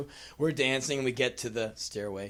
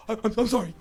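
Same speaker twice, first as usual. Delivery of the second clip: higher, stammered mock mortification; mortified apology; 9 semitones higher, much faster than usual. Rejected girl apologizing to the boy, mortified. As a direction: imitate a flustered, stammering apology. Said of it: "like, just mortified".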